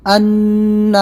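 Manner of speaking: "ان" with the n held humming in the nose; nasal ghunna. Shown as a demonstration. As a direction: sustain a steady nasal n on "ان". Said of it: "ناک میں تھوڑی دیر آواز کو چھپانا".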